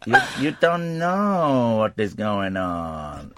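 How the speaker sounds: slow, drawn-out impression of a woman; imitated woman. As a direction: stretch each word, lightly imitating her. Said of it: "the woman saying".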